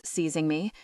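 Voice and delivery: ordinary delivery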